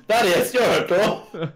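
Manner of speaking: loud, high, drawn-out mock cry; hammed-up shout amid laughter; mock exclamation. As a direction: belt it out like a ridiculous title. Mock-announcing an episode title, amid laughter.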